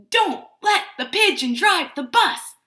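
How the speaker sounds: loud, punchy storytime title; excited title announcement. Real, not acted. Announcing the favourite book's title.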